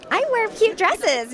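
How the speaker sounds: mock the old airhead Daphne, high and slow; old ditzy Daphne. Voice actress mocking how Daphne used to sound, sugary and high. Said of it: "She's not just this".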